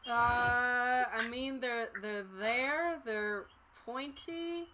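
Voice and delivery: slow, soft, utterly bored clerk; tired bored cashier. Acted. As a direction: answer like nothing matters. Bored cashier answers the customer.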